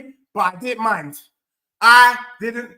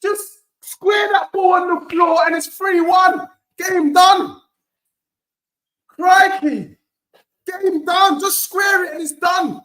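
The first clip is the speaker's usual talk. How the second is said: excited shout; 7 semitones higher, slower than usual. Real, not acted. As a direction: yell at the screen. Excited during an attack.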